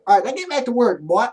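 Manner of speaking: a hint of a southern boss drawling "boy"; southern accent. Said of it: "didn't even mean to put a southern accent on it".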